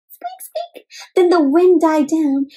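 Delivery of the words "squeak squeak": tiny high squeaks like a hidden animal. Acted. Narrator imitating the strange squeak in the meadow, very high and soft. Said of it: "heard a strange squeak".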